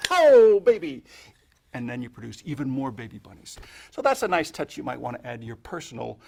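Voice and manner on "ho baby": high, loud showman's whoop; big finish whoop. Shown as a demonstration. Magician to the spectator, big finish with a loud whoop. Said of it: "you go".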